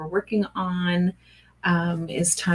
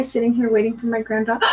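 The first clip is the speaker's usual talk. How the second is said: ordinary talk, nothing marked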